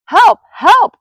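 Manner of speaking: high, very loud yelps for help; panicked cry. A narrator's reading. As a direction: shriek it out as a frightened little Piglet. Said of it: "cried Piglet".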